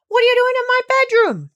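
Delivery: startled king crying out in the dark; loud indignant yell; alarmed cry. Acted. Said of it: "cried the king".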